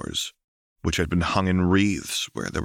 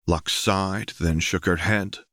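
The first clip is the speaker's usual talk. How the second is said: plain reading voice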